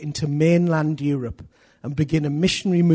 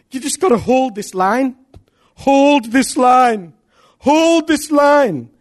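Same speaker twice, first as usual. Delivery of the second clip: slow, louder, higher "Hold this line"; rallying cry; 6 semitones higher, 6 dB louder, slower than usual. Acted. Preacher urging congregation to stand firm, slow and loud. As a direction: drive it home, each word held.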